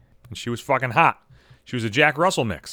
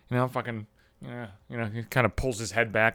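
ordinary talk

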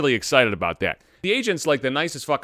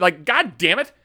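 usual speaking voice

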